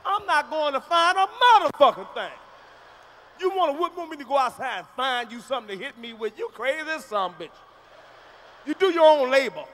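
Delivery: defiant shouting. Retold or imitated. Kid refusing to fetch a switch, loud and defiant.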